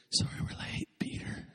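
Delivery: soft unvoiced whisper, unhurried; hushed and easy, no stress at all; calm whisper. Jesus calmly apologizing to the group for being late, whispered.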